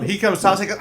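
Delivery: usual speaking voice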